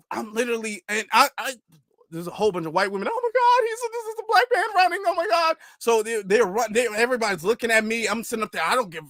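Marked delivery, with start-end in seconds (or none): 3.1-5.5 much higher, breathless gasping of an alarmed lady; mock panicked onlooker. Mimicking the startled women watching him sprint down the hallway, high-pitched alarmed gasp. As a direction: squeal it like a scandalized bystander.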